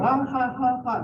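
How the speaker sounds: high taunting ha ha ha; imitating kids' jeering laugh; mocking laughter. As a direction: laugh at the crying students. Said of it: "They were".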